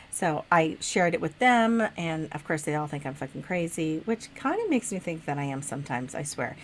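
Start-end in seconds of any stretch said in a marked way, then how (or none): none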